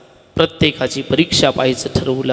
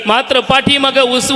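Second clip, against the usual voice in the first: ordinary talk, nothing marked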